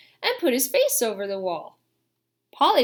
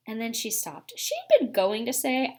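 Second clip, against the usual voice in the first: plain reading voice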